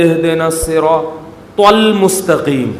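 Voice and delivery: المستقیم said slowly with a heavy exaggerated ت; mock mispronunciation. Preacher mimicking an imam who only knows ت, slow mock recitation. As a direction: poke fun at the imam leaning on ت. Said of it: "کہتے ہیں".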